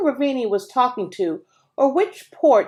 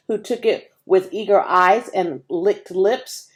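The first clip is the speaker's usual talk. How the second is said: usual speaking voice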